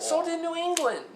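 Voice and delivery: very high, piercing retort; squealed argumentative comeback; high-pitched comeback. Real, not acted. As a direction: shoot back way up in pitch. Shouted comeback in heated argument.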